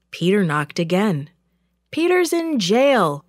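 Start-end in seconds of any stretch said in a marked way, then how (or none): 1.9-3.2 higher-pitched doubter from the room; disbelieving voice, pitched up; another voice. A narrator's reading. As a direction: object in a higher, different voice. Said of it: "said another voice".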